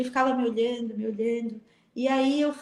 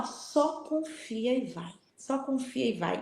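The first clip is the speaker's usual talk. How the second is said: very slow and softer; gentle saint's voice; 7 dB quieter, slower than usual. Retold or imitated. Padre Pio in her dream urging her on, softly and slowly. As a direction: hush it out like a calm reassurance.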